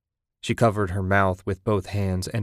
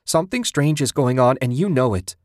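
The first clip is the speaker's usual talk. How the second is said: higher, louder insistent shout; 5 semitones higher, 5 dB louder than usual. A narrator's reading. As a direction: make him admit it, loud. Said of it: "Susan shouted".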